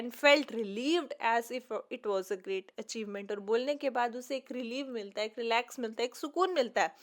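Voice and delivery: normal talking voice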